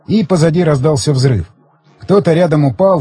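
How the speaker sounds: usual speaking voice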